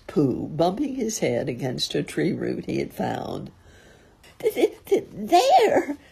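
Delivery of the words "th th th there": high stuttered squeal; stutter, then squeal the word loudly; frightened stammer. A narrator's reading. Piglet showing Christopher Robin the heffalump, terrified stammer. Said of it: "said Piglet".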